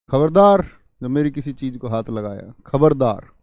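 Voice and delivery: loud, stern warning. Shown as a demonstration. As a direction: warn them off hard. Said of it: "चेतावनी बोधक". (ज़ोरदार कड़ी चेतावनी)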